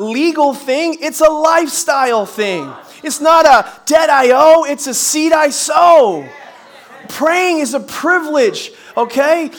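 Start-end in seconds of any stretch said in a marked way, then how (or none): 3.0-6.3 louder, higher, slow punchy delivery; preaching climax. Real, not acted. Preacher driving home his rhyme to the congregation, louder and slow. 7.1-9.5 emphatic preaching. Real, not acted.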